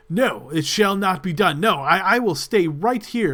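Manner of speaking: loud, sneering refusal; sneering guard. Acted. Guard refusing the persuasion attempt, loud and sneering. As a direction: sneer down at them and dig in. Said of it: "very sneering look upon his face".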